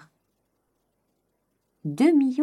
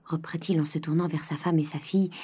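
plain reading voice

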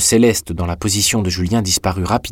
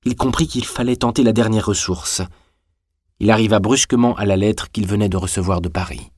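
ordinary delivery

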